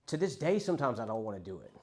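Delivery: ordinary talk, nothing marked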